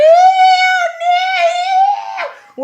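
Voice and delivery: high, very loud screech of disgust; full-out revolted squealing; screaming. Retold or imitated. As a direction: imitate kids shrieking, grossed out. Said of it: "We're all screaming and making noise".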